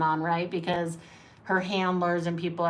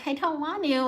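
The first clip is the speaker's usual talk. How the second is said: put-on reluctant child; 8 semitones higher than usual. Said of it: "and you're like".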